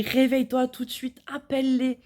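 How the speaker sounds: panicked, rushed order; urgent plea. Retold or imitated. Waking her husband in panic.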